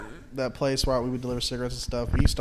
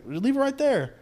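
sharply higher mock demand; mock store owner; 10 semitones higher, slower than usual. Store owner demanding the carton stay, high mock voice. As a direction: snap the order up high. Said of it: "he's like".

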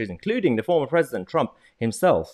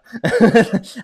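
loud high laugh breaking out; burst of laughter; 12 dB louder than usual. Real, not acted. Host laughing at his own struggle with the name, loud laugh. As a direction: crack up at the word.

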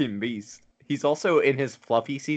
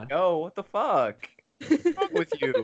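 higher-pitched mock outburst of blame; mock indignation; 7 semitones higher than usual. Friend mimicking a dad who flips from sorry to blaming, mock indignant. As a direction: turn the imitated apology into exasperated blame. Mocking excuse turns to blame.

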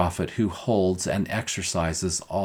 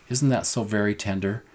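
ordinary talk, nothing marked

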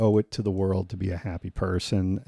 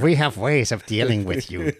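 slow, menacing mock German villain voice; a creepy German threat, drawn out; menacing German accent; 3 semitones higher, 5 dB louder than usual. Podcast host mimics a German villain for his cohost, menacing slow German accent. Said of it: "that accent kinda creeps me out".

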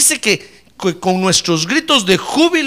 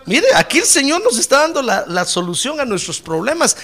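usual speaking voice

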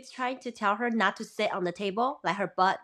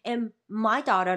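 normal talking voice

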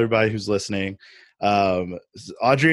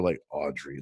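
deep Audrey voice; 8 semitones lower, 7 dB quieter than usual. Pretend. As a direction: lower and softer, trying to reach a bass. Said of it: "I can't get deep enough for".

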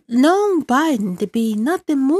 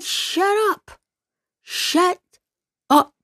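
exasperated shut up; 9 semitones higher, much slower than usual. Real, not acted. Fed up with Fauci everywhere.

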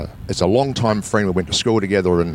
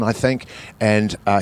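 usual speaking voice

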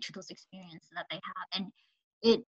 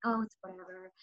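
sulky dismissal; 3 semitones lower, 11 dB quieter than usual. Retold or imitated. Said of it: "I thought he was mad".

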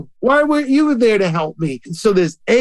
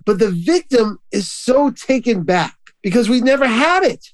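ordinary delivery

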